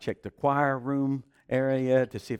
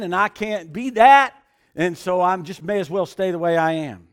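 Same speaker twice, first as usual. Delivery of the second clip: louder, higher pleading argument; arguing with God; 6 semitones higher, 8 dB louder than usual. Retold or imitated. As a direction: push back hard against the voice.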